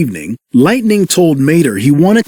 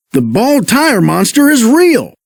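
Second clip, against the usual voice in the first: shivering; 7 semitones higher than usual. Acted.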